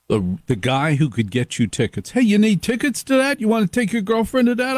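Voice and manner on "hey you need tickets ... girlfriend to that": high, fast salesman pitch; eager ticket hustler. Retold or imitated. Host imitates the fraternity ticket guy, high and fast hustle.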